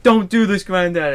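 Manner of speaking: put-on high, loud childish plea; pleading grandson. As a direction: beg like a cornered kid. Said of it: "where he's like".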